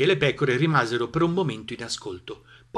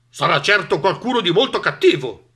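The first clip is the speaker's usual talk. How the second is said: loud, lightly raised dog; 6 semitones higher, 8 dB louder than usual. A narrator's reading. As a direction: announce it gravely like a dog. Dog guessing the villain. (Cane che decide serio)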